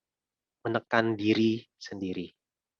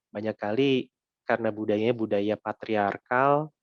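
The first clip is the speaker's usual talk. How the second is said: normal talking voice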